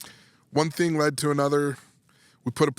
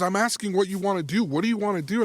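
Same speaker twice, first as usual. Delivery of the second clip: imitated wife pressing, pitched up; high, emphatic demand; retold insistent questioning; 8 semitones higher than usual. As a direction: press for an answer, reenacted.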